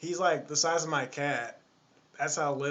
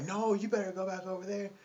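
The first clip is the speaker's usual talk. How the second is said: higher-pitched mock scolding; bossy woman; 7 semitones higher than usual. Lady sends the following baby back, mock bossy scolding. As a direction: imitate a woman sending a child back.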